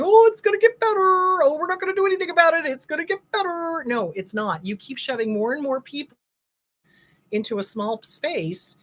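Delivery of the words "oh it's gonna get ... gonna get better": high, loud sing-song dismissive tone; mocking naive voice. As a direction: parody the clueless optimists. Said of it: "putting your head in the sand and going".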